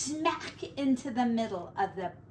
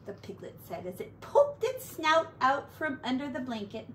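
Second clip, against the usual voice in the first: ordinary delivery, nothing marked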